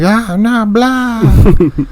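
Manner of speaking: high, slowed-down mock husband. Husband at garbage disposal, slow high mock voice. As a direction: stretch it out high like a flustered man.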